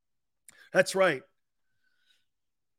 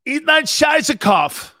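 high, slow, loud foreign holler; drawn-out mock German outburst; mock German shout; 9 semitones higher, 10 dB louder, slower than usual. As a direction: bellow it like comic German.

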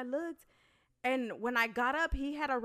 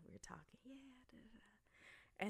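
hushed aside; 20 dB quieter than usual. Retold or imitated. Hushed phone chatter aside.

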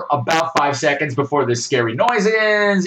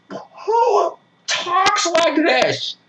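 much higher, very slow disbelieving question; incredulous; 13 semitones higher, much slower than usual. Retold or imitated. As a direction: stretch it out in disbelief. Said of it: "I'm just going".

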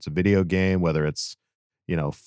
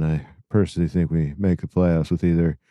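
tired dad voice; 4 semitones lower than usual. Acted. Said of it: "Tired dad voice for the win".

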